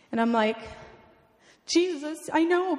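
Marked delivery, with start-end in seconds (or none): 1.7-2.8 higher-pitched puzzled comeback; raised-pitch, mildly exasperated objection; retold protest. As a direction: re-enact an impatient "yes, but". Retold impatient reply to God.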